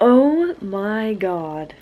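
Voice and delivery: very slow, drawn-out reaction; stunned disbelief. Real, not acted. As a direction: stretch each word out in amazement. Stunned by the book's news.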